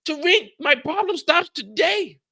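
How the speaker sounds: hamming up a British gangster boss; mock British accent. Recapping the boss going off, in a mock British accent. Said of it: "I don't know how to do a British accent".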